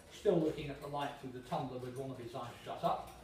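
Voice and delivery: usual speaking voice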